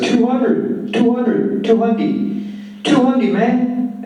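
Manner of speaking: excited high-pitched gangster voice. A narrator's reading. Gangster waving money at the pimp, high and insistent. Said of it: "the gangster says".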